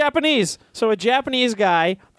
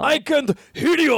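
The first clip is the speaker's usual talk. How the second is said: put-on high, slow samurai-style Japanese accent; 5 semitones higher, slower than usual. Finishing the slogan as a samurai, fake Japanese accent. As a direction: declaim it slowly as a hammed-up Japanese warrior. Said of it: "you do one in a non-racial Japanese accent".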